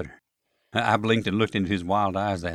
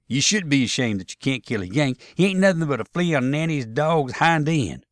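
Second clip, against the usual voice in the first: raised, louder Southern scolding; scornful; 6 semitones higher, 5 dB louder than usual. Acted. Tom scolding Jeb for sparing a Yank, scornful. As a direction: shame him with contempt for the enemy. Soldier scolds ashamed comrade.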